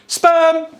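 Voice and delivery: high, loud announcer cry; excited shout. Acted. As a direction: belt it like a title jingle. Shouted show title jingle.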